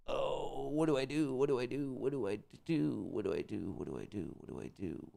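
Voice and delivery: repeats fading down to a whisper; fading fretful mutter. Real, not acted. Trader frets over a trade, fading to a whisper.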